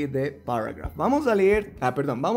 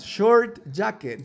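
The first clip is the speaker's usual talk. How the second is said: slow and clear, short pushed up high; slow model reading; 4 semitones higher, much slower than usual. Shown as a demonstration. Teacher repeating for David, slow and clear. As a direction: repeat it slowly for the learner. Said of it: "Una vez más".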